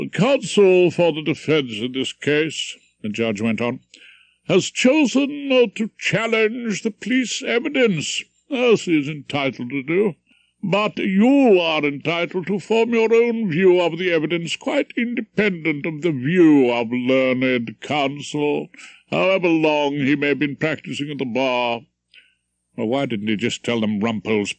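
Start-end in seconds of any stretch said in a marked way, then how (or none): 0.1-2.8 slow, higher, formal judge's voice; pompous old judge addressing the jury; stern judge. Acted. 4.5-21.8 put-on pompous old judge addressing the jury; stern judge.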